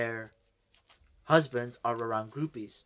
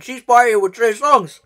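hyped shouting rant; 10 semitones higher, 16 dB louder than usual. Pretend.

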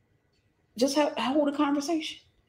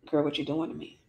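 low, soft sweet-talking man; hushed, low lover's come-on; acted smooth suitor; 7 semitones lower, 7 dB quieter than usual. Acted lovers' late-night phone talk.

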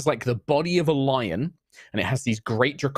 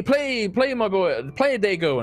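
much higher, pressing a drummer to keep playing; urging call; 10 semitones higher than usual. Hunt leader calls to his drummer boy, high urging voice. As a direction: cheer the drummer on, high and eager.

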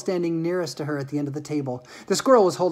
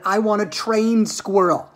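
slow, higher, demanding shout; 5 semitones higher, 5 dB louder, slower than usual. Acted. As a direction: stretch out the child's loud demand. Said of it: "Veruca shouted".

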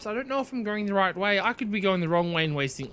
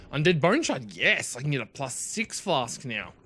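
ordinary delivery

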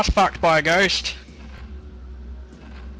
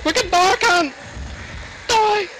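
high frightened yelps; scared shrieking; 13 semitones higher than usual. Real, not acted. High panicked cries at monster.

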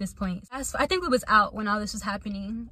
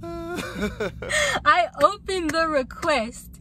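breaking into laughter mid-sentence; giggling as she reads the request; laughing; 7 semitones higher, much slower than usual. Real, not acted. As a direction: laugh through the words. Laughing while telling the story.

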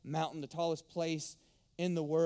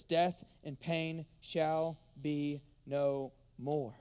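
slow, deliberate proclamation; slow triumphant verse to death; slow emphatic reading; 3 semitones lower, much slower than usual. Acted. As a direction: lay every word down slowly. Preacher reads verse to death.

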